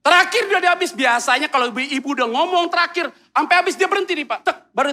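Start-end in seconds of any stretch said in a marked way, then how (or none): none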